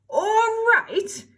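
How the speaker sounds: high, loud indignant cry. Acted. Cindy crying out at the fairy, high and loud. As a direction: fling the words back in outrage. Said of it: "cried Cindy".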